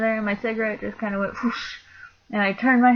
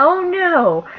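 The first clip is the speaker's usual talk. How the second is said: very high, loud dismayed cry; shocked oh no; 10 semitones higher, 7 dB louder than usual. Retold or imitated. Manager hearing the news on the radio, a shocked high cry. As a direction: gasp it out in shock. Reaction over walkie-talkie.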